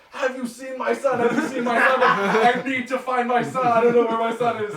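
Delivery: high-pitched frantic mock panic; hammed-up desperate mother searching for her son. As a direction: plead like a panicked mom, very high. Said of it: "she's frantic".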